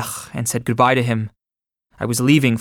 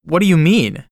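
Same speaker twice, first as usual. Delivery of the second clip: a raised, startled question; exclaiming; 5 semitones higher, 3 dB louder than usual. A narrator's reading. A man told he is dead, exclaiming to the clerk. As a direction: ask it in open disbelief. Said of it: "I exclaimed".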